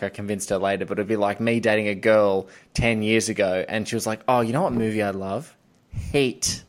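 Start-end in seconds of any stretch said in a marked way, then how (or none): none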